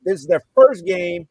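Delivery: loud, high, furious shout at the ref; angry yelling. Retold or imitated. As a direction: let the anger climb to a yell.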